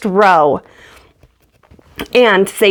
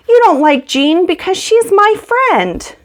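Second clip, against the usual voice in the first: put-on raised, higher voice for an upset girl; Laura's hurt retort; 10 semitones higher than usual. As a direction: snap back like a wounded friend. Laura quarrels with Grace.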